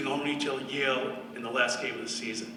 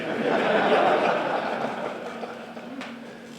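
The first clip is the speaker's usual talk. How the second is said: breathy laugh; 7 semitones higher than usual. Real, not acted. Laughing after own joke.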